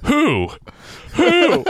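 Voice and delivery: very high, loud hooting who; a silly mock owl call. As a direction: hoot like a startled owl.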